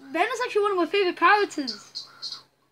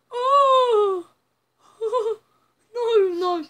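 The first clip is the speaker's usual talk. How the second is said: pained cry; 6 semitones higher, 8 dB louder, much slower than usual. Real, not acted. Sees Ben hurt.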